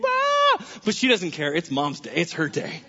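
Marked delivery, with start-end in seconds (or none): 0.0-0.7 sudden high mock shriek; high toddler scream, imitated. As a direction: shriek like a toddler being dropped off. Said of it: "Little one is just screaming".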